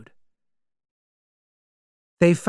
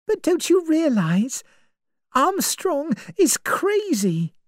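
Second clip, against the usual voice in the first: put-on higher, anxious young woman; young woman's voice; 14 semitones higher than usual. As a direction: voice Vera as uneasy and searching. Vera warns Lombard.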